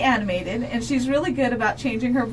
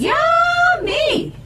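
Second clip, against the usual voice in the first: excited shout; 6 dB louder than usual. Real, not acted. Cheerful show intro shout.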